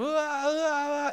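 put-on high whiny baby wah-wah; mock toddler wail. Father mimicking his crying toddler, high mock wail. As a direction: imitate a little kid wailing. Crying kids in meltdown mode.

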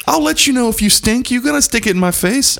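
high-pitched, exasperated comic protest. Retold or imitated. Husband tells wife not to stick it in his face, exasperated. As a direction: plead it high, in mock indignation.

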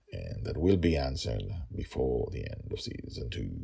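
plain reading voice

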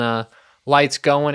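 ordinary delivery

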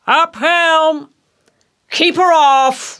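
high, commanding sea captain's call; old lion's cry. Acted. Ahab to the steersman, high lion-voiced cry. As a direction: cry the order out to the helm. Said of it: "cried out in his old lion voice".

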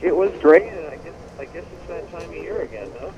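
muffled, distant, like in a tunnel; phone line with tunnel echo. Real, not acted. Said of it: "sound like you're in a tunnel".

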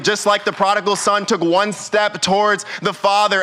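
normal talking voice

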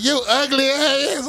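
put-on high, slow, scornful girl voice; squeaky mock of a girl; mocking girl's voice. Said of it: "She was like".

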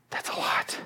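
hushed, sighing whisper; overwhelmed sigh. Real, not acted. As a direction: let it out on a long exhale. Overwhelmed by the listed enemies.

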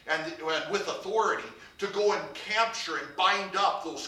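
loud, rising sermon build, slowing on the key words; full-force preaching climax; fervent preaching. Acted. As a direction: drive it home from the pulpit.

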